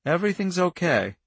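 lightly voiced child, collected and detached; calm child. A narrator's reading. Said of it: "Her voice was calm, collected, and strangely indifferent".

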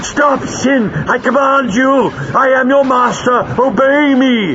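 high, drawn-out furious roar; Greel roaring. A narrator's reading. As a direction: bellow orders as an enraged master. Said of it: "roared Greel".

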